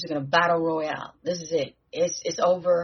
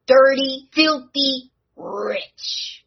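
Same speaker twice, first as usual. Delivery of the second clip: higher, spitting out dirty and filthy loud; a venomous villain's greedy sneer; poisonous sneer; 7 semitones higher, 5 dB louder, slower than usual. Retold or imitated. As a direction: twist each word like a greedy villain. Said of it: "he said it with the most, you know, the most horrible, poisonous accent".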